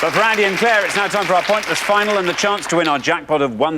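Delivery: host announcing the final; announcer build-up. Acted. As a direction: sell the jackpot to the room.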